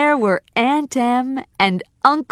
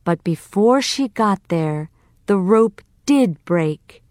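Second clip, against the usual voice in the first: usual speaking voice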